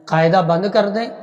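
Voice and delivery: ordinary delivery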